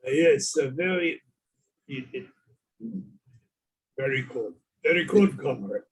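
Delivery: a hint of a Russian accent; lightly put-on Russian accent. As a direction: answer like a Russian comrade. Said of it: "it must be very cold in Russia".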